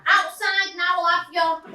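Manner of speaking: imitating a scally picking a fight; mock scally shout. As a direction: call him out to fight.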